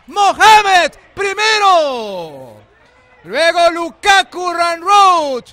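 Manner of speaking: high, loud, slow triumphant call; finish line shout. Real, not acted. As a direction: shout out the winner. Winner crosses finish line. (grito de llegada a la meta)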